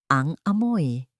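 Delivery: plain reading voice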